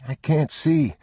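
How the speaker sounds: put-on a kid lost in the dark; scared boy. A narrator's reading. Boy blind in the dark holding his father's hand. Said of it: "the boy said".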